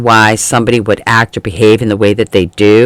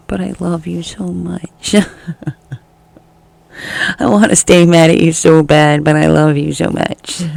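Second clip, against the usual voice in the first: higher, swooning lovesick voice; mock torn lover, helplessly adoring; lovesick gush; 6 semitones higher than usual. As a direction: gush like someone melting with love. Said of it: "the bigger part of you that's like".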